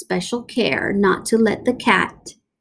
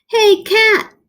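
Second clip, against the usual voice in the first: higher, louder call; shout; 12 semitones higher, 6 dB louder than usual. Acted. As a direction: taunt the cat from afar. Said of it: "he shouted".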